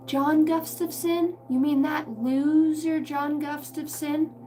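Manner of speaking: put-on slow, high, cranky old grandpa voice; grumpy old man. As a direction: drag it out like a crusty old coot. Grumpy Old Men grandpa impression.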